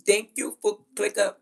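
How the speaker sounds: mimicked Indian accent. Foreign caller cut off by the mother, mock Indian accent. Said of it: "she hear that goddamn accent".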